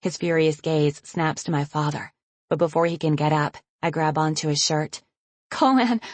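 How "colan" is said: high, pleading cry of his name. Acted. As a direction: cry out to him for help. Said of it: "I cry".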